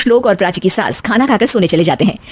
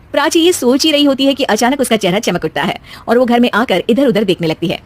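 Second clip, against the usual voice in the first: normal talking voice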